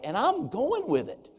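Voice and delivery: high-pitched, gleeful comeback; playful mock defiance. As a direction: agree defiantly, pitch jumping up. Acted defiant answer to family.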